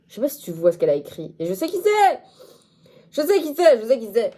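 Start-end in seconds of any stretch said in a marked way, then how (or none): 1.4-4.3 excited insistence. Real, not acted. Excited accusation at commenter.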